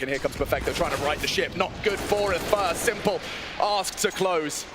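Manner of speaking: usual speaking voice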